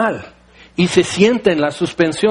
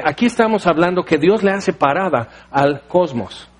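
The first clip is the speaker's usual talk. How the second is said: usual speaking voice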